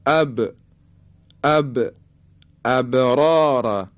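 "ابرار" with the ب bounced before the r; qalqalah on ba. Shown as a demonstration. Teacher showing the ba's qalqalah, bouncing ب in "ابرار". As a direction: recite "ابرار" slowly, releasing ب with an echo. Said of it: "دقت کنید حرف با رو".

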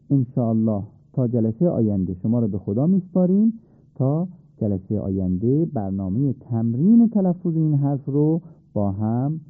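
usual speaking voice

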